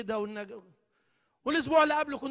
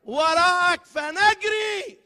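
slow, loud high cry; bride's plea; 8 semitones higher, 13 dB louder, much slower than usual. Retold or imitated. Preacher voicing the bride's call, slow loud plea. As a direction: stretch it out like a longing call. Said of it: "فاكرين العروس قالت له إيه؟".

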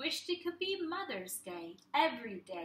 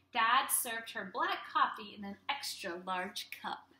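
ordinary delivery, nothing marked

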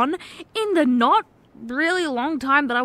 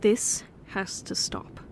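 slow, soft, serious whisper; slow it down and plead softly; slow whispered plea; 2 semitones lower, 5 dB quieter, slower than usual. Acted. To the drunk partner in bed, slow whispered plea.

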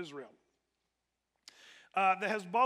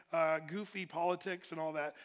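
ordinary talk, nothing marked

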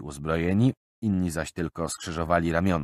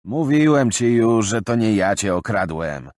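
bristling; 4 semitones higher, 7 dB louder than usual. Acted. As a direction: bristle at the accusation. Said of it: "żachnął się".

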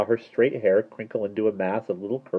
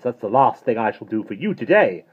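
louder, higher snappish retort; 4 semitones higher, 6 dB louder than usual. A narrator's reading. Mary Poppins refusing Mister Turvey more help, snappish. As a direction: say it with a cross snap. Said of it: "snapped Mary Poppins".

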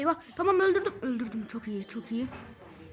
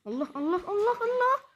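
frantic panic; 9 semitones higher than usual. Real, not acted. Panicking as enemies attack.

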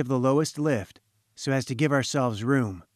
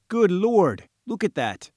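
high, louder burst, then dropping; startled exclamation; 6 semitones higher, 3 dB louder than usual. A narrator's reading. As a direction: exclaim at a sudden alarming sight. Engineer startled by the heaving surface.

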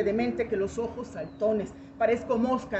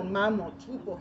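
usual speaking voice

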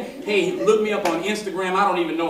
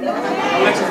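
calling out; 14 semitones higher, 6 dB louder, slower than usual. Real, not acted. Calling her up to podium.

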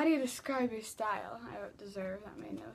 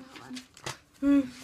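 sudden alarm; 8 dB louder than usual. Real, not acted. Nearly falling off ball.

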